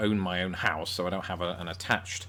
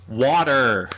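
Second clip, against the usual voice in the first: loud American "wadder"; loud mock-American outburst; put-on American accent; 3 semitones higher, 9 dB louder than usual. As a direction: give in and blurt it in an exaggerated American accent. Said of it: "kind of like how with water I had to".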